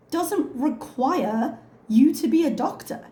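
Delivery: usual speaking voice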